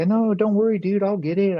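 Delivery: high-pitched, loud upbeat reassurance; acted upbeat. Debtor brushing off his dealer's demand for money, upbeat. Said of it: "kind of upbeat".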